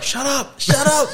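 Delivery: very high, urgent retold plea; mock panicked hushing. Dad to screaming toddler in a public toilet, mock panicked hushing. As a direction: re-enact a flustered dad, voice pitched right up.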